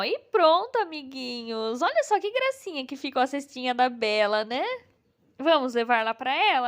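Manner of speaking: ordinary talk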